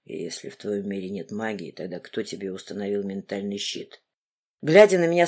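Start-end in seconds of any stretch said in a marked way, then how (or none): 0.1-4.0 low, quiet menacing man; a grim interrogator, lowered; stern man's voice. Acted.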